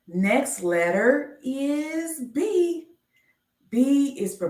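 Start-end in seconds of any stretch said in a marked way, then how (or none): 0.1-2.9 slow, higher, stretched letter reveal; slow sing-song reveal of the next letter; drawn-out announcement. Acted. Slow game-show style letter reveal.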